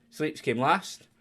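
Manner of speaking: ordinary delivery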